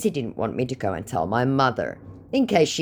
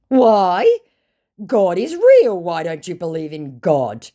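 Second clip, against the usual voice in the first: high, loud outraged put-on voice; hammed-up scandalised believer; mock horrified scammer; 6 semitones higher, 7 dB louder, slower than usual. Scammer shocked she doesn't believe, in a high loud mock voice. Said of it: "absolutely astounded and horrified".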